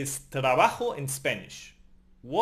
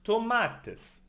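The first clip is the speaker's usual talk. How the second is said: "tomates" with -ma- raised high; stress on penultimate syllable; 6 semitones higher than usual. Shown as a demonstration. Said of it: "On the penultimate syllable".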